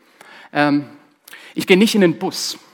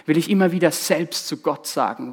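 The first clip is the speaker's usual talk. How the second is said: ordinary delivery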